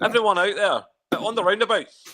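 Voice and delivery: mock protest leader shouting; mock rally cry. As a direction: rouse the crowd onto the roundabouts. Mock French rally call.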